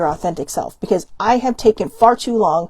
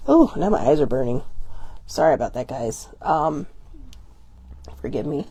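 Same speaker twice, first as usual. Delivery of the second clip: lower, softer, recovering from real crying; tearful apology; 5 semitones lower, 6 dB quieter than usual. Real, not acted. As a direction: apologize quietly through stinging tears. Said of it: "crying, 'cause then it just makes it burn".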